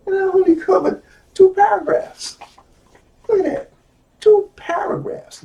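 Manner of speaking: high-pitched amazed exclamation; high, disbelieving emphasis; incredulous. Real, not acted. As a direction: marvel at it, pitch jumping way up. Amazed at how little was covered.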